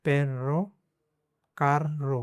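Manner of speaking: पेर then रो with a break for a rolled rr; divided syllables. Shown as a demonstration. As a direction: split पेर रो in two. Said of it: "इसको divide करके पढ़ना है". ("पेर रो" दो हिस्सों में तोड़कर)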